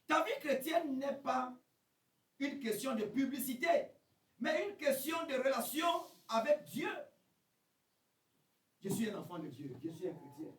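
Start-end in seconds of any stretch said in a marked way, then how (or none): 0.1-6.9 fiery sermon shout; preaching at full force. Acted. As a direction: drive the message home to the congregation.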